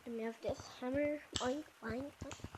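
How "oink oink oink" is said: pig oinks. Pretend. Showing a pig-sounding hammer.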